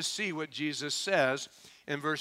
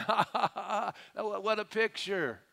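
mock crowd laughter; 6 semitones higher than usual. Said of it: "people started laughing".

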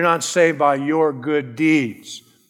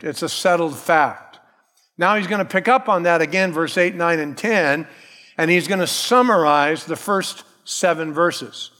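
ordinary talk

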